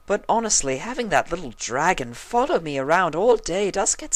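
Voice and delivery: high, haughty lady; dramatic, fussy pony; Rarity's voice. A narrator's reading. Rarity explaining why Spike bothers her, high and haughty.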